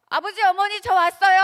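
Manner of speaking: loud, high, bright call home; cheerful child arriving. Retold or imitated. Child walks in and calls out to parents: loud happy call. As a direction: burst through the door calling out.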